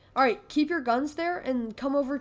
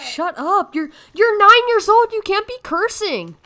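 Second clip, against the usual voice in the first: high, shouted telling-off; loud scolding; 8 semitones higher, 10 dB louder than usual. Real, not acted. Scolding kid for cursing.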